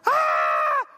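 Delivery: put-on loud sudden scream of a hurt girl; a sharp panicked yell; retold scream. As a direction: cry out as if in pain. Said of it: "She's screaming".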